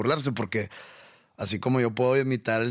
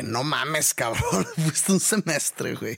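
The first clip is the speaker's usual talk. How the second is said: higher, louder teasing scoff with a laugh; laughing at the friend for faking an accent; mocking scoff; 5 semitones higher, 3 dB louder, slower than usual. Teasing the friend who came back from Madrid, laughing scoff.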